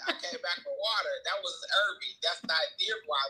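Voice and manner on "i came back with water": laughing aside. Real, not acted. Laughing while telling.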